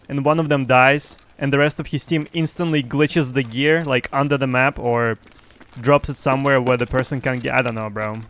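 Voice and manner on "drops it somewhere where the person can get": ordinary delivery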